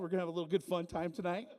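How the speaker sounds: ordinary talk, nothing marked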